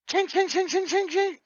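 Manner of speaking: high pinging ching ching; high-pitched metallic ching sounds; BB pinging noise. Retold or imitated. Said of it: "then I hear".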